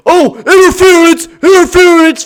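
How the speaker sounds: screaming at the referee, high and loud; furious shout. Real, not acted. Gamer screaming at the ref over a bad call, furious. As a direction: yell the call out in outrage.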